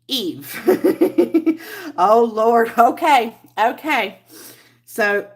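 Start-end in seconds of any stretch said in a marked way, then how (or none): 0.6-1.9 high giggle; real, high-pitched laugh. Real, not acted. Laughs after stumbling over the greeting.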